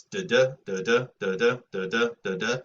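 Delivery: da syllables tapped out in rhythm; iambic beat. Shown as a demonstration. Teacher demonstrates iambic pentameter rhythm to class, rhythmic. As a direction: beat out the meter da da. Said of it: "so that's five beats, rising beats".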